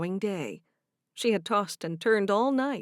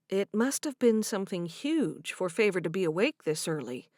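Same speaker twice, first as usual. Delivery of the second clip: usual speaking voice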